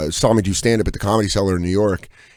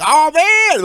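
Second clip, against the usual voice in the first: high, hammed-up reunion cry; mock delighted greeting; 3 dB louder than usual. Seeing school friends after summer break, excited greeting. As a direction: gush as if spotting an old pal.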